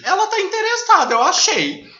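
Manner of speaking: high, loud matchmaking squeal of words; gleeful, high-pitched playful accusation; excited teasing. Pretend. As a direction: tease a friend at full volume. Teasing co-host about her interest.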